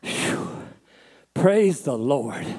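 exhaled phew, then slow drawn-out praise; big sigh of relief, comically drawn out; hugely relieved. Acted. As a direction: let it out slowly after a narrow escape. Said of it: "He is so relieved".

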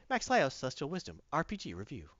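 low, quick show-title announcer. Acted. Title announced after epic intro.

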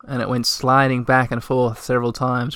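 usual speaking voice

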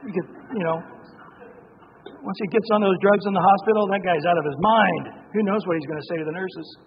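ordinary talk, nothing marked

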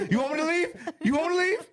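high, laughing mock outrage; laughing disbelief.

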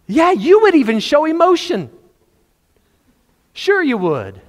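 high, loud excited cry. Real, not acted. Teasing the congregation about emotion, high excited cry. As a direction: burst out with emotion.

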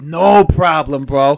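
loud, slow, drawn-out bro voice; a dopey chill bro; bro bee. Acted. Bees voiced as bros.

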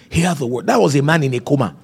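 ordinary talk

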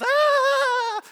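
high startled scream; sudden high ah of panic; terrified army cry. Acted. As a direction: yelp like a fleeing soldier. Army flees in terror.

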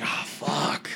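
soft, voiceless retold groan of defeat; hushed dread. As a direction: breathe it out under your breath, sinking. Retelling dread as staff wave him over.

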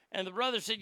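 usual speaking voice

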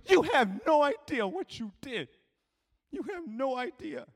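high, urgent insistence; intense confrontation. Retold or imitated. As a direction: confront him with overwhelming feeling. Girl grabs him, confronts him.

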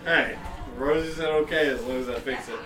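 normal talking voice

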